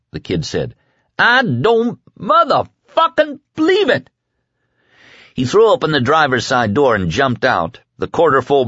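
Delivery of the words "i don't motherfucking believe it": very high, word-by-word fury; enraged disbelief. A narrator's reading. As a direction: punch out each word separately in outrage. Kid repeats it, enraged.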